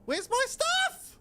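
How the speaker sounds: high, slow, stretched-out cry of disbelief; outraged disbelief. Real, not acted. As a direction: demand your stuff back in shock. Streamer outraged at lost inventory.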